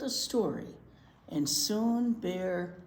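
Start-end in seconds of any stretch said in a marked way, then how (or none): none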